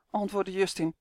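ordinary delivery

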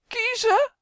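high, loud bellowed command; roar. A narrator's reading. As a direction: bellow it suddenly, pitched far up. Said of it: "brult meneer Jas".

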